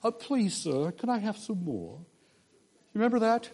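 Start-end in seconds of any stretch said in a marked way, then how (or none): 0.0-2.0 higher-pitched pleading orphan boy, lightly voiced; a light, polite plea, starting high; Oliver. Hungry orphan asking Mister Bumble for more, lightly voiced pleading. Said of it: "I can't do it in a British accent".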